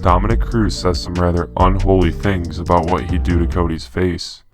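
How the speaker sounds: normal talking voice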